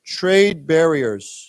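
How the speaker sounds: very slow model. Shown as a demonstration. Teacher reads line for students to echo, very slow. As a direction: spell it out slowly for learners. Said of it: "let's read this".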